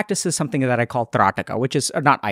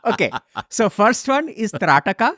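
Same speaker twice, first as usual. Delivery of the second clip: exaggerated Indian guru voice; high, hammy Indian accent; 7 semitones higher than usual. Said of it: "I love your Indian accent".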